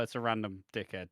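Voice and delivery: ordinary talk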